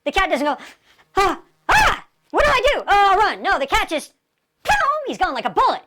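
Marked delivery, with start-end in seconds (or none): 1.2-3.4 high-pitched, hammed-up dithering fright; comic panicked yelp into words; mock panic. Acts out a dithering startled cat. 4.6-4.7 high, sudden boom like a cat bolting; imitated instant getaway; explosive boom. Shown as a demonstration.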